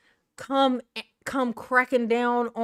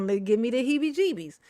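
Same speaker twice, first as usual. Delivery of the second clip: normal talking voice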